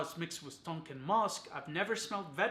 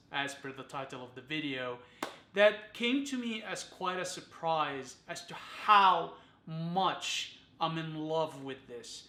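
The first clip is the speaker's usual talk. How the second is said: ordinary talk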